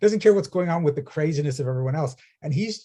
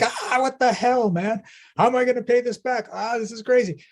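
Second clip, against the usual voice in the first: high, pitched-up panic over a surprise bill; panicked outburst; 11 semitones higher, 3 dB louder than usual. Retold or imitated. Speaker imitating her old self at a five thousand dollar bill, mock panicked cry. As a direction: freak out at the bill, half-mocking yourself. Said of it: "I'm like".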